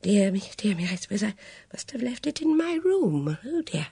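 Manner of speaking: put-on old lady's high, breathy mutter; old lady muttering while rummaging in her handbag; muttering to herself. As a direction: fret under your breath like a fussing old lady. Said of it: "She was muttering to herself".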